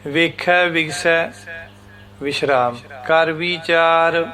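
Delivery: slow recitation, resting on ਵਿਗਸੈ; pause after ਵਿਗਸੈ. Shown as a demonstration. Teacher reciting Gurbani slowly with a pause after ਵਿਗਸੈ. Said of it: "ਇਸ ਤਰ੍ਹਾਂ".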